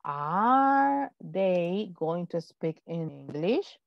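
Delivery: very slow, rising question; slow model question. Shown as a demonstration. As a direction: drag it out for the class. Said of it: "Ajá". (pregunta modelo muy lenta, subiendo)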